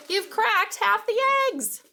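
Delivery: high, loud accusing exclamation; scolding outcry. Acted. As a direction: cry out in dismay at the clumsy helper. Mouse scolds clumsy Tiny.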